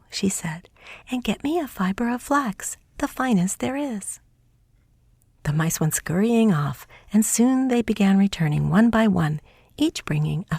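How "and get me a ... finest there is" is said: higher little mouse giving orders; a tiny princess commanding her servants; high mouse voice, light command; mouse princess ordering. Acted.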